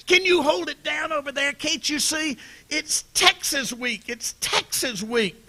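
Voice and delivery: high mock yell at the noisy runners; retold coach yell. Football coach mock-yelling at noisy runners to hush. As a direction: play the coach blowing a gasket, half-shouted. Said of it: "he screams".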